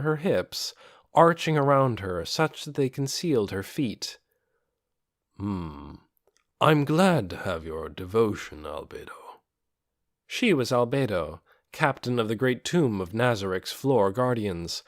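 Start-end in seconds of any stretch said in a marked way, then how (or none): 5.4-9.4 a calm dark lord thanking his servant; undead lord voice. A narrator's reading. Ainz voiced in story.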